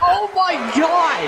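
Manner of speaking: high, slow drawn-out shout of shock; stunned yell. Real, not acted. Commentators stunned by a jumping elbow, drawn-out yell. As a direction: stretch it out in disbelief. Both react to bionic elbow.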